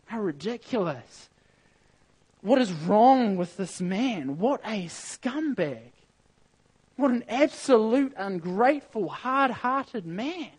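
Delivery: slowed, rising disbelief at the servant; indignant outrage. Real, not acted. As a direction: pour real scorn on the ungrateful man. Preacher indignant at the unforgiving servant.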